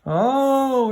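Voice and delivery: a high, loud, queasy moan; sick moan. A narrator's reading. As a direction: moan it out like your stomach is turning. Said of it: "Eric moaned".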